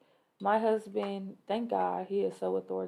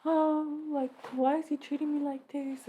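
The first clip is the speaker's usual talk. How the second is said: higher, whiny imitation of her younger self; mock whiny kid; 7 semitones higher than usual. Younger self whining about her dad, higher whiny voice. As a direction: whine like a hurt kid.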